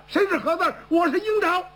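high declaring shout; proud shout. Acted. Jiang Ping shouting to the bandit: bold, high proclamation. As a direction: announce yourself boldly, high. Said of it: "蒋平喊这么一嗓子".

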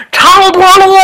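a high, loud yell of 长脖鹿 from afar; loud shout. Acted. Someone in the back yard bellowing the nickname, high and loud. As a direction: bellow the name from the backyard. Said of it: "有人大喊一声".

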